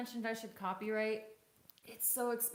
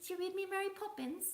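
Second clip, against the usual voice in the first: put-on childlike British voice; 13 semitones higher than usual. Wife plays along as child.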